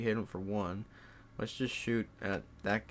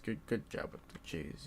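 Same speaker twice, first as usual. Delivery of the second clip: soft, half-voiced aside; whispered praise; 9 dB quieter than usual. Real, not acted. Player whispers praise at his own roll, quietly. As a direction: breathe the praise out under your voice.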